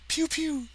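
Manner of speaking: high, squeaky pew pew gun noise; playful zap zap sound effect; mouth gun sound. Acted. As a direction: make the laser blasts with your mouth. Proton gun firing sound effect.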